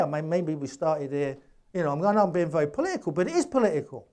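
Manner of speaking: ordinary delivery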